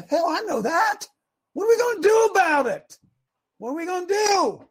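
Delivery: exasperated, high, loud coach's outburst; seemingly mad. Acted. Coach to his assistants at halftime, exasperated shouting. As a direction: yell it like a fed-up halftime coach. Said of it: "I'm not mad. It just seems like it".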